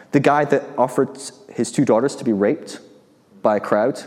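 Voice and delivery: ordinary talk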